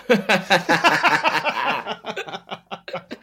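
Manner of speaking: a big warm burst of laughter at her own punchline; hearty laugh. Real, not acted. Guest laughing at her own joke: hearty laugh. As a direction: laugh out loud, delighted, after the gag lands.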